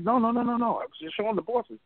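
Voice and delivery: animated imitation of a boss objecting; retold protest.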